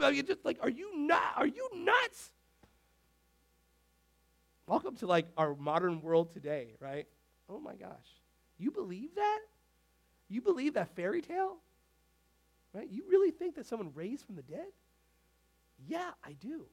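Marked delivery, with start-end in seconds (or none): 0.6-2.3 high shouted disbelief; scoffing shout. Retold or imitated. Outsider shouting at a believer, high scoff. 8.6-14.4 higher, sneering rising disbelief; mocking skeptic. Skeptic mocking resurrection belief, higher sneer.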